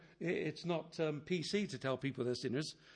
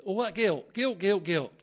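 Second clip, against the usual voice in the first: louder, higher, hammering each guilt; mock-outraged repetition; 4 semitones higher, 8 dB louder than usual. Preacher voicing a listener's mock-outraged complaint. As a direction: pile on the guilt in mock dismay.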